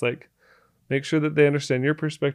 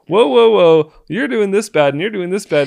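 defensive; 5 semitones higher, 7 dB louder than usual. Retold or imitated. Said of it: "I'd be like".

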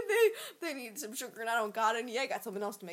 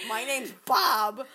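put-on drunk uncle name; 9 dB louder, much slower than usual. Drunk uncle introducing himself.

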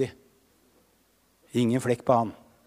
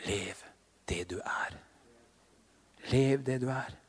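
whispered, much softer, like a prayer under the breath; murmured prayer; 2 semitones lower, 9 dB quieter than usual. Real, not acted. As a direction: breathe it to her outside the mic. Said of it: "mumler jeg inni meg sånn utenfor mikrofonen". (hviskende bønn for jenta)

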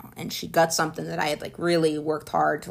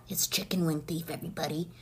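unvoiced stage whisper, much softer; conspiratorial whisper; 10 dB quieter than usual. Real, not acted. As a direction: let the audience in on a secret. Naming the rival in secret.

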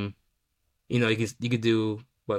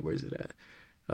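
whispering to himself; breathy slow whisper; 12 dB quieter, slower than usual. Real, not acted. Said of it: "searching, repeats under breath".

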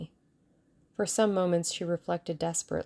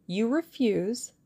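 slow, menacing question, pitched up; ugly ring; 4 semitones higher, slower than usual. A narrator's reading. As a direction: draw out the challenge threateningly. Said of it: "There was an ugly ring in the Russian's voice".

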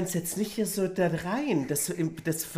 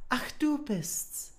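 princess voice; 2 semitones higher, 4 dB quieter than usual. Acted. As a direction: sound like a haughty little princess. Princess greets the frog.

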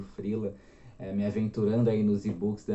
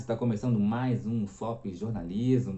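usual speaking voice

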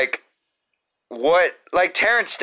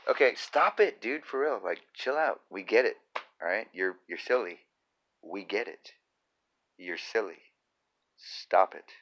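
quiet scolding; 3 semitones lower, 8 dB quieter than usual. Acted.

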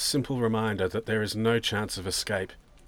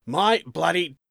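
louder, higher impatient outburst; exasperated; 7 semitones higher, 7 dB louder, slower than usual. Acted. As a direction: snap it at the clueless young man. Angry father seeks missing daughter.

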